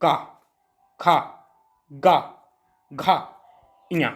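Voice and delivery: teacher drilling "का खा गा घा इया" from the throat; guttural sounds demo. Shown as a demonstration. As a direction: sound out "का" "खा" "गा" "घा" "इया" one by one with pauses. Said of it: "बोलो".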